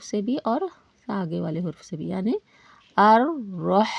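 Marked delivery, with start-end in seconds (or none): 3.0-3.9 "आर रोह" with a doubled, held r, said louder; doubled r demo. Shown as a demonstration. Teacher demonstrates tashdeed to students, doubling the r loudly. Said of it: "ऐसा पढ़ेंगे".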